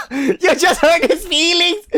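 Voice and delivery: high, loud, slow laughing tease. Pretend. Listener teasing the human about Rannoch, laughing through it. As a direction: giggle the words out at him.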